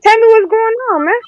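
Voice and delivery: high, pleading voice. Real, not acted. Caller pleads to join.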